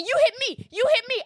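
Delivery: high-pitched mock of the old woman accusing; mimicking old lady. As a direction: parrot her shrill accusation.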